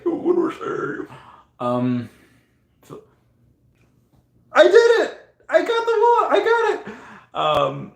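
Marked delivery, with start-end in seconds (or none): 0.0-1.1 tiny, very high drawn-out voice; playful falsetto fluster; squeaky panic. Pretend. 4.5-6.9 squealing high celebration; loud, giddy victory cry; triumphant. Real, not acted. Celebrates winning guess.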